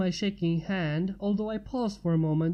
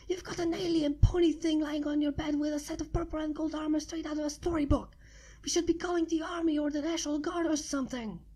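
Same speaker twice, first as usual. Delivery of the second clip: higher, frantic, rushing, fast at the end; rising alarm, words tumbling; panicked; 7 semitones higher, 3 dB quieter, faster than usual. A narrator's reading. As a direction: spill it out in a panic. Panicked friend rambling.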